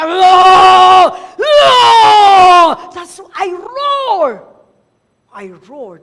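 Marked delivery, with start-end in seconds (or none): 0.0-2.9 loud lion-like roar; battle roar; roaring shout. Retold or imitated. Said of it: "And I say". 3.0-4.3 loud preaching. Real, not acted. Preacher still shouting.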